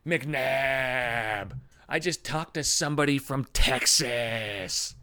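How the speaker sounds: slow, dopey put-on voice; lightly voiced coworker, drawn out and clueless; mock Strauss. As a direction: coworker rolling over to McNabb, dopey and slow. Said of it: "she's like".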